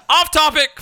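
loud, high mock lifeguard yell; shouted call-out. Imagined lifeguard keeping the podcast on track, loud high yell. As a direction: holler it like a referee's call. Acts lifeguard calling them off topic.

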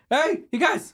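high, loud winded call; breathless hailing; out of breath. Acted. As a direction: shout to friends at the end of a hard run. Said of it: "Oh, God, I'm out of shape".

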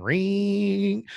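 high, sustained mechanical ringing; imitated air compressor ring. Storyteller mimics the air compressor at two a.m., high drawn-out ring. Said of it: "air compressor turns on".

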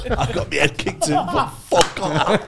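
laughing in disbelief. Real, not acted. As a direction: protest through real laughter, stretching the last words. Everyone cracking up at punchline.

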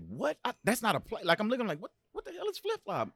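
high, baffled disbelief; young player baffled by the call; confused. Retold or imitated. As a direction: squeak out total confusion. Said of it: "I'm like".

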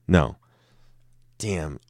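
ordinary talk, nothing marked

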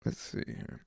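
whispered murmur while looking something up; a real half-heard aside while reading; whispered to self. Real, not acted. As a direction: mutter it to yourself under your breath. Muttering while searching his screen.

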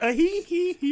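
high giggling "hee hee hee"; mimicked joke-machine cackle. Pitching the replacement for the singer's squeal, high mock cackle. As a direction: squeal it out like a toy laughing. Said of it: "replace [name]'s".

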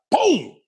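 high, sudden bang; gunshot "boom". Retold or imitated. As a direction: burst out with an imitated gunshot. Imitates the gun going off.

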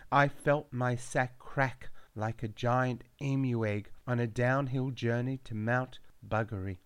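plain reading voice

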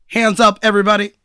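a light, loud Obama pastiche; lightly voiced president holding up a bank; mock Obama robbery line; Obama impression. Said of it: "That's my best Obama".

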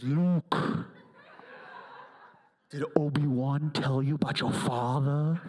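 a deep, loud, menacing movie-villain voice; hammed-up Vader, low and heavy; Darth Vader. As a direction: loom over Luke with a booming low tone. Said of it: "He goes".

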